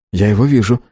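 usual speaking voice

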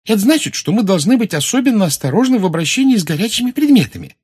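very high, loud schoolgirl chatter; excited. Acted. Parvati explaining what Mars causes, excited and high. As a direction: rattle off the knowledge eagerly, climbing high. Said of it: "возбуждённо сказала".

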